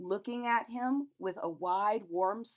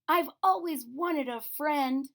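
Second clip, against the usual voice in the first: wondering teddy bear; 7 semitones higher, 5 dB louder than usual. A narrator's reading. Corduroy voiced in story.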